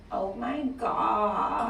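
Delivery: slow, drawn-out vocal fry; creaky voice. Shown as a demonstration. Tutorial on sounding like a Valley girl, creaky voice. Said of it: "Two, use creaky voice".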